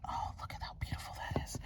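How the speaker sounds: quick, very soft whisper of wonder; awed whisper. Real, not acted. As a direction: whisper in admiration, no voice.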